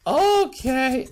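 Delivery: high-pitched mock consent; silly, high-voiced agreeing ruler; agreeable Emperor. Emperor agrees to Paul's demand.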